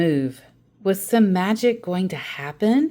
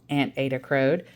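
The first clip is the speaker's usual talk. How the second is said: ordinary delivery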